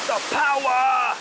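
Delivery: mock-epic cry of wonder; hammed-up awe. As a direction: declaim it grandly. Marvelling at waterfall.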